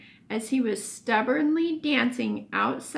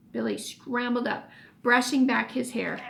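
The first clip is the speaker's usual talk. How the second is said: plain reading voice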